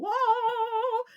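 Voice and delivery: high, loud playful whoa; a kid swinging in a tree; Tarzan whoop. Acted. Kevin playing Tarzan up the tree, high gleeful whoop.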